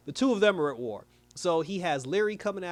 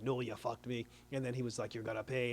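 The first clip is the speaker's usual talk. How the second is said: low, much softer retold threat; quiet threat; 4 semitones lower, 8 dB quieter than usual. Leary warns the boss he will pay, hushed and low. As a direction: imitate a hushed, lowered warning.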